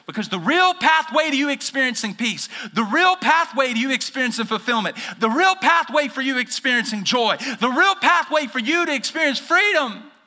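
building preaching. Acted. Pastor preaching to the congregation, building fervour. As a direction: build the repeated line to a peak.

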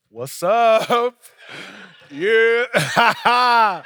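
loud, drawn-out mock-enthusiastic hail with a big laugh; overdone hearty greeting. Pastor greeting the doorman he dislikes, overdone loud heartiness. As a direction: force the friendliness, loud and laughing.

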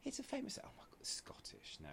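hushed, breathless whisper; mock-horrified whisper to the listener; whispered aside. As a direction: whisper it like a scandalised gossip. Mocking aside about confused accents.

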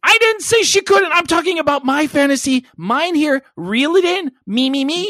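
squeaky, high, whiny protest; high mock protest. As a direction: whine it up high like a spoiled kid.

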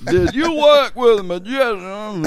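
put-on high, slow, loud old man; old guy voice. Fake IRS agent questioning the comedian, old guy voice. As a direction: drag it out like an elderly official. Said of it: "He does this old guy voice".

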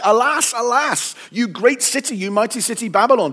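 loud, high cry of alas; fearful lament. A narrator's reading. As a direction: raise the voice in a dramatic scriptural lament. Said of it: "in fear of her torment and say".

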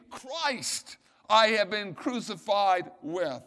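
"Christ" hit loud and drawn out; emphatic preacher stressing "Christ". Shown as a demonstration. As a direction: punch the word "Christ" first, then slow down for the rest. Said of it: "The emphasis upon who?".

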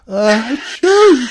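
big high sneeze; loud sneeze. Acted. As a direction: sneeze it out loudly. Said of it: "he heard some very loud noises".

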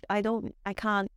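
usual speaking voice

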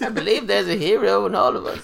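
high put-on accented old lady voice, laughing through it; hammed-up Aunt May speech, high and giggly; Aunt May impression. Showing off his Aunt May impression to his friends, laughing. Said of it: "That's why my impression's so good".

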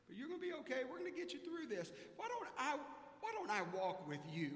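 a hint of the kind teacher soothing him; caring teacher, reassuring. Miss Lucille comforting the dad.

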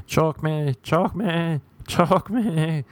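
mock girlfriend begging. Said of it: "the girl's like".

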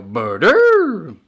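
loud high creature grunt; grunting monster word. Acted. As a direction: bellow it like a dumb beast. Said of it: "Which means".

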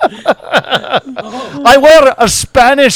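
put-on giggling Scottish-accented Connery; Sean Connery impression. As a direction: stretch it out in a Scottish burr, laughing. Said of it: "he's like".

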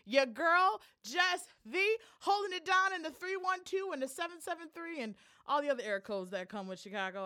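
bright, high radio host intro. Acted. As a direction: greet the listeners like a DJ opening the show. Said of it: "your radio voice game is".

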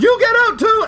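very high, loud comic shout; mock outrage. Comedian banishing a heckler, loud mock shout. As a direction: yell it in playful fury. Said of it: "And he goes".

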